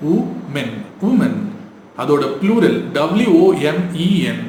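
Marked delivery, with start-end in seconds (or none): 0.1-1.3 women said as WU-mun; singular pronunciation. Shown as a demonstration. Teacher modelling the singular, singular pronunciation. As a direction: say women with an oo opening. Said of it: "It is woman".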